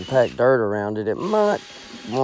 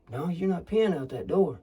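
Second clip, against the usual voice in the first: half-raised scolding voice; 5 semitones higher, 6 dB quieter than usual. Acted. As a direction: stop whispering and scold him. Argument escalates, voice rises.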